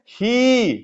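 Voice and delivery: ही with a long, drawn-out ee; stretched vowel. Shown as a demonstration. Teacher showing how to stretch ही into a long ee. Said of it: "इसको खींचेंगे".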